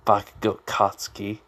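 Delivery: plain reading voice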